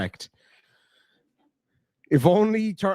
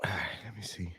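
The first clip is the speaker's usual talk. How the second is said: whispered aside; 8 semitones lower, 16 dB quieter than usual. Real, not acted. Sighs, mutters to himself.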